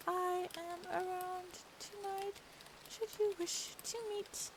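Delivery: high, slow telegram read as a demure lady; prim letter voice. Acted. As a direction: read it like a coy lady writing a note. Reads her character's telegram aloud.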